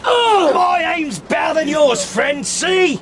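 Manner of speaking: high, loud mocking shout; shouted taunt. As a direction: crow over the man he just hit.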